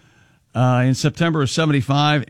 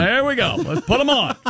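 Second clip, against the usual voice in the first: mock rally cheer; 8 semitones higher than usual. Mariners bench players rally while losing.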